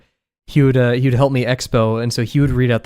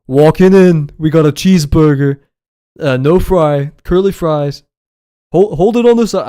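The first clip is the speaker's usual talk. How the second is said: loud, higher New Jersey kitchen call; New Jersey boss accent; 4 semitones higher, 10 dB louder than usual. Retold or imitated. Boss reading out tickets to the line cooks, loud New Jersey accent attempt. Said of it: "I can't do the accent".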